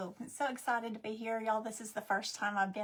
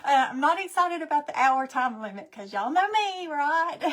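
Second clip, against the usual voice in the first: laughing; 3 semitones higher, 7 dB louder than usual. Real, not acted. Joking about the time limit.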